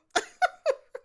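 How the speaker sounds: very high, loud shriek of laughter; squealing laugh. Real, not acted. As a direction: shriek with glee. Laughing after laying on chest.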